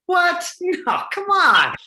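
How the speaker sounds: loud, high-pitched disbelief, drawn out; incredulous. Shown as a demonstration. Coach showing the actor what the line means, incredulous. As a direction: protest it in outraged disbelief. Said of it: "what you're saying is like".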